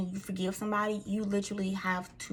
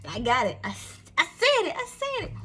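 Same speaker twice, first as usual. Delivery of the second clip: squealed, much higher, proud; triumphant; 8 semitones higher, 4 dB louder than usual. Real, not acted. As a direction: celebrate finally getting the word out. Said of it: "hard for me to say this word".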